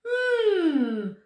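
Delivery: high, loud, drawn-out hum; puzzled hum. A narrator's reading. Man ponders Milo's question, high puzzled hum. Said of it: "scratching his head".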